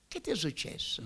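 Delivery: softly voiced, curious question; hushed, lightly acted inquiry; sister's question. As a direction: imitate a sister quietly probing.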